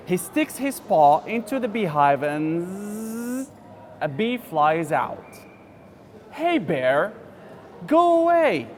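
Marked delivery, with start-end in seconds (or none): 6.3-8.7 high squeaky bee voice, loud; cross little bee. Acted. Teacher voicing the bee telling the bear to go away. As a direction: shoo the bear off in a tiny shrill bee voice. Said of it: "says the bear".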